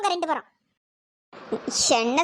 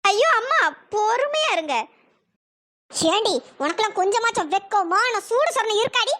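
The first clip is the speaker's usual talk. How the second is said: ordinary delivery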